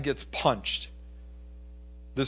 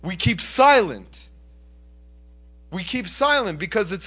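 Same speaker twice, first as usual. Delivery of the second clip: high, loud, emphatic preacher; urgent sermon delivery, pitched up; forceful preaching; 8 semitones higher, 7 dB louder than usual. Acted. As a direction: drive each phrase home from the pulpit.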